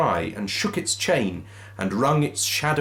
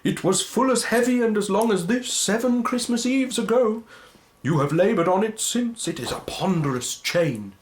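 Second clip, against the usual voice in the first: Marley's ghost lamenting to Scrooge; mournful ghost; 12 semitones higher than usual. Acted. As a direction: wail your fate like a damned spirit.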